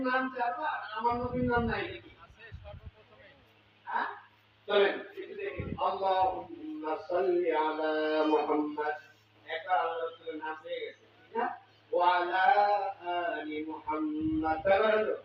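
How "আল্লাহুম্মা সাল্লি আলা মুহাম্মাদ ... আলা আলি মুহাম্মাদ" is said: durood recitation. Acted.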